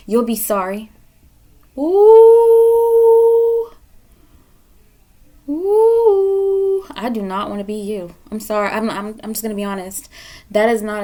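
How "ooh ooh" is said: high, loud, drawn-out spooky ooh; hammed-up haunting ooh; mock ominous ooh. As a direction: tease them with a ghostly ooh. After warning "you'll be sorry.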